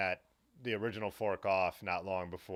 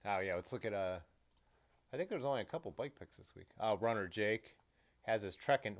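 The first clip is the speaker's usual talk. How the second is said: ordinary talk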